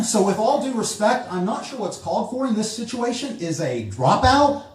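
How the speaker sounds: high, loud, incredulous questioning; sarcastic exasperated husband. Acted. Husband with possessed wife answering a dropout priest, exasperated. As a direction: challenge him in mounting mock exasperation.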